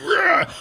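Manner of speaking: short mock monster roar; high, strained rage roar; Incredible Hulk. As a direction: imitate a raging brute's growling roar. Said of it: "he was like".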